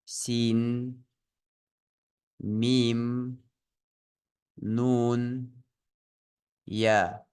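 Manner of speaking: each letter name drawn out on its own, like با with a long vowel; letter-name drill. Shown as a demonstration. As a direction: model سين and ميم slowly for learners, holding the sound. Teacher models letter names for repetition.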